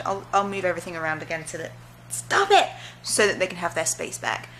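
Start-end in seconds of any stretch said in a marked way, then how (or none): 2.1-2.7 sharp scold. Real, not acted. Scolding the cats.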